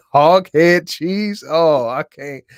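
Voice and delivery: slow, high, loud disgusted outburst; real disgust at a chat food suggestion, loud and high; grossed out. Real, not acted. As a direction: drag out the words in real revulsion.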